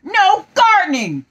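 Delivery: high, loud shout; yelling Rabbit. Acted. Rabbit yelling at Tigger in the quarrel, high and loud. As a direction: shout it right at him. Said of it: "yelled Rabbit".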